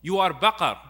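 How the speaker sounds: mock shouting. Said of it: "he starts shouting towards him".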